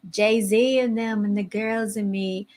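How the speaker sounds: imitating slow Southern drawl; slow, lazy Southern accent imitation. Shown as a demonstration. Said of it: "kinda like this".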